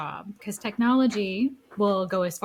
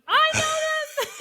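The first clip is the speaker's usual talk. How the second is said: put-on excited kid; 3 dB louder, much slower than usual. Excited kid recognizing tune.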